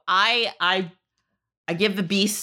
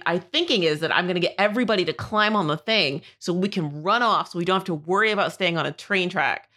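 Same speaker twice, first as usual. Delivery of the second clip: ordinary talk, nothing marked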